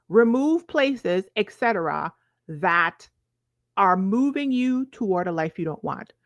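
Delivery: usual speaking voice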